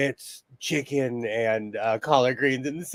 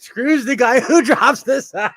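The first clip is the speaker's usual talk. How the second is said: higher, louder, cracking up mid-sentence; laughing; 7 semitones higher, 6 dB louder than usual. Real, not acted. Teasing someone about dropping the soap, laughing. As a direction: laugh through the punchline. Cracking up at own crude joke.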